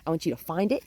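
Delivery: ordinary talk, nothing marked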